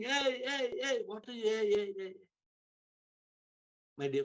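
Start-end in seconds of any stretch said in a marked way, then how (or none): 0.0-2.1 high mock yelling, no real volume; retold screaming. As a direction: imitate useless repeated warning cries. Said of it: "Instead of going on screaming".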